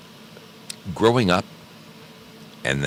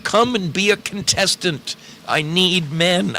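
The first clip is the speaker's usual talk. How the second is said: begging; 10 semitones higher than usual. Real, not acted. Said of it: "I'm begging right now".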